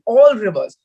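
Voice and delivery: "all" hit hard and drawn out, falling; stress on all. Real, not acted. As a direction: lean on the word "all" before rivers. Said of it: "I would like to emphasize the word all".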